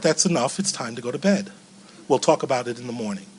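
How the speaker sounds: soft, calm settling-down tone; hushed, gentle parent voice; soothing bedtime voice. Retold or imitated. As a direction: quietly put a child to bed. Voicing therapist's soothing advice.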